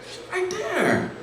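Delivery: high, strained warning to the friend; high strained warning with the girlfriend standing there; panicked hiss. Retold or imitated. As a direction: squeak it out, caught red-handed. Panicked reply in front of girl.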